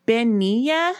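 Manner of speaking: high rising query; puzzled. A narrator's reading. Sybil asking about a strange word, puzzled. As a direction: echo it as a baffled question. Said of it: "puzzled by the strange word".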